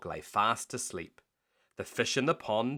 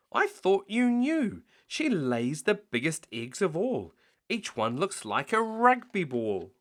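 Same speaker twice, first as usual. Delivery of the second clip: higher coaxing goose voice; sly goose; 7 semitones higher, 4 dB louder than usual. Acted. Goose to the thieves, higher coaxing. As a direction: sell the lie sweetly.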